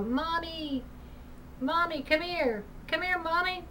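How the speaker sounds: put-on a small child calling faintly for her mother; little girl calling. Ghostly little girl calls for mommy from afar, high and soft. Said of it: "I kept hearing a little girl, and she would call".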